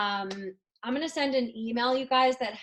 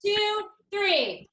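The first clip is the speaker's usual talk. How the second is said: high, loud count-in; cheer countdown; 11 semitones higher, 8 dB louder, much slower than usual. Real, not acted. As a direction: count the team in with raised voice.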